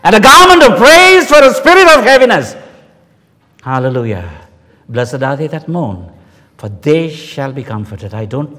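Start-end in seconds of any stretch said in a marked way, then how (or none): 0.0-2.5 loud, high, ringing pulpit cry; full-force preaching. Acted. Preacher proclaiming God's comfort to grieving listeners, loud and soaring. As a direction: lift the congregation with a soaring proclamation. Preacher peaks on scripture line.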